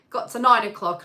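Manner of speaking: ordinary talk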